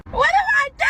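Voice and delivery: high, loud wail of what do I do; hammed-up anguished shout; desperate cry. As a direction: cry it out like the world is ending. Mock desperate outcry.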